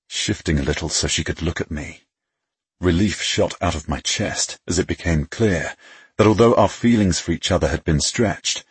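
usual speaking voice

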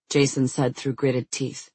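ordinary delivery, nothing marked

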